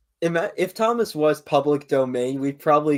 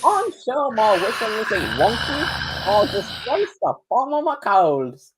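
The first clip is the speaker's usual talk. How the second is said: high, slow squeaky engine voice; slow Thomas impression; 8 semitones higher, slower than usual. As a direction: drag out the squeaky cartoon voice. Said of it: "repeat of the read, slower".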